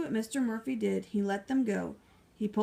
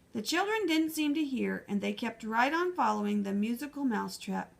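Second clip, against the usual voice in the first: usual speaking voice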